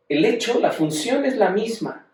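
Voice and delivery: usual speaking voice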